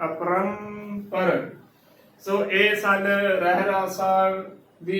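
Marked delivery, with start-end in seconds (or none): 0.1-1.5 very slow "ਅਪਰੰਪਰ", apram, then par; correct pronunciation. Shown as a demonstration. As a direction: draw out the nasal in "ਅਪਰੰਪਰ" and drop on par. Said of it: "ਅਪਰੰਪਰ ਉਚਾਰਨ ਕਰਨਾ ਹੈ".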